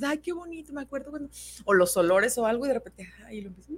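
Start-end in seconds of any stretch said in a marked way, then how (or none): 0.0-1.3 higher and much softer, dreamy; nostalgic sigh. Retold or imitated. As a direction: drift into a fond memory with a sigh. (suspiro nostálgico, agudo y muy suave)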